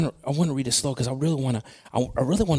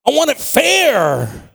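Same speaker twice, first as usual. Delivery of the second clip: high, loud, stretched-out complaint; indignant protest; 7 semitones higher, 6 dB louder, slower than usual. Acted. Preacher voicing people's objection that scripture is unfair, indignant protest. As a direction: build the objection, dragging out the last words. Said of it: "we say".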